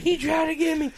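high, silly startled squeal; mock a friend jumping back in a squeaky voice; Rob's stupid voice. Storyteller mimics his friend after the lunge, high mock alarm. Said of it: "Rob jumps back and is like".

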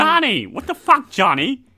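high, loud incredulous impression; exaggerated, baffled impersonation; Bobby Mayer. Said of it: "you definitely got his tone right".

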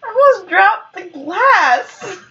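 high, slow words through laughter; real giggling squeal, barely recovering; breathless amusement. Real, not acted. Friend nearly dropped the glass, cracking up. Breaking up laughing, near accident.

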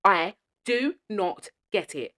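each word slow and deliberate; slow emphatic. Real, not acted. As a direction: spell it out in disbelief. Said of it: "I'm so passionate about this".

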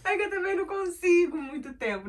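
laughing through the words; struggling to hold back laughter; trying not to laugh. Real, not acted. Fighting laughter while telling fight.

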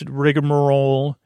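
ordinary delivery

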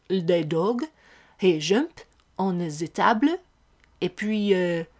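put-on heavy French accent. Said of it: "He was like".

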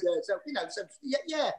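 ordinary delivery

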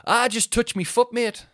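high, loud blokey voice; chirpy lad offering a helpful tip; bright, sing-song mate in the pool; swimmer's cheery tip. Retold or imitated. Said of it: "he was like".

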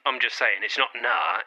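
ordinary talk, nothing marked

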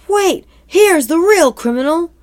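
high, loud acted call; urgent accusation. Zoo worker exposing the real criminal, high urgent shout. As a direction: burst in and halt everyone.

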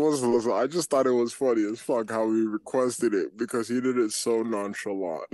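usual speaking voice

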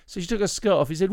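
normal talking voice

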